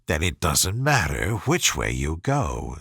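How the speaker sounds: low, sly cat. A narrator's reading.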